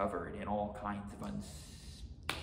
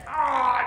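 high, loud cry of alarm; ogre's alarmed yell; 12 dB louder than usual. A narrator's reading. Oni with samurai on his chest, high yell. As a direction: cry out sharply at full volume. Oni yells as hero climbs.

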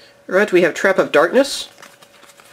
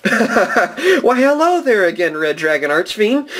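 delighted greeting; 6 semitones higher, much slower than usual. Real, not acted. Delighted to pull the card again.

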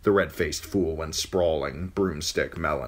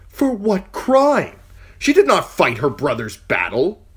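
loud, higher, slowing angry challenge; angry demand; 13 semitones higher, 9 dB louder than usual. Acted. Uncle confronting the knight, loud and angry. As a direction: demand it with cold fury.

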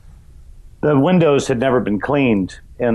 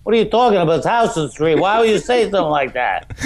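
incredulous New Yorker; 6 semitones higher, faster than usual. Retold or imitated. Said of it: "people would go".

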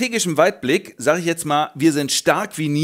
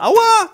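sudden high yelp of pain, much louder; mock pain yelp; 8 dB louder than usual. Slain elf prince crying out, high comic pain yelp. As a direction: squeal out a comic ouch.

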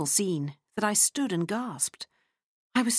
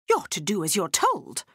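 plain reading voice

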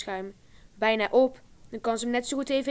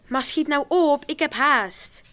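louder, higher irritated urging; impatient hurry; 5 semitones higher, 5 dB louder, slower than usual. A narrator's reading. Alexander urging Rosa to hurry, irritated and louder. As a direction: tell her to hurry, fed up. Annoyed brother at door.

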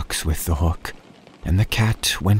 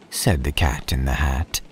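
plain reading voice

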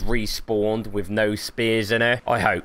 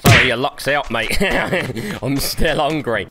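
ordinary talk, nothing marked

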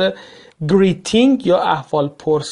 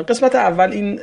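ordinary talk

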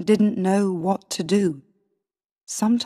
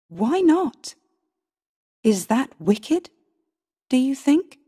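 higher, insistent appeal to the lords; exasperated self-defence, rising into challenging questions; indignant plea; 3 semitones higher than usual. Acted. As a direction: protest your innocence, pitch leaping on the questions. Pleads with her accusers.

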